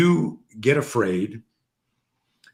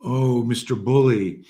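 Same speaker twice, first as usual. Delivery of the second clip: drawn-out, goading mock alarm; mock taunting; 3 semitones higher, 4 dB louder, slower than usual. Dad goading his son to punch, mock taunting. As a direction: tease as if daring a small opponent.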